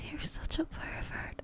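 playful hushed scold; teasing whisper. Acted. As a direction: tease him under your breath. Teasing hush.

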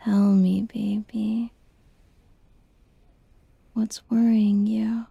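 ordinary talk

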